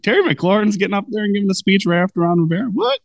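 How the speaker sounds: high-pitched, quick disbelieving question; mock astonishment. Host recalling seeing the receiver give a speech, astonished. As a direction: squeak the last word in disbelief. Quoting own surprised reaction.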